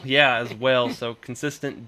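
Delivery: ordinary talk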